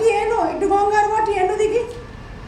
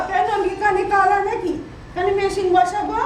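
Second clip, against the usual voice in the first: ordinary talk, nothing marked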